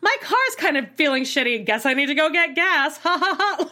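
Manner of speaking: hammed-up chirpy laugh; mock cheery texter. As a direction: parody a clueless guy's chirpy text. Mocking his daily texts.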